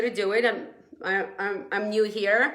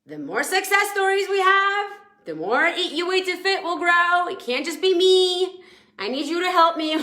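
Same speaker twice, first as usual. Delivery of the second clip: rallying plea; 6 semitones higher, 4 dB louder than usual. Real, not acted. Rallying her weight-loss group.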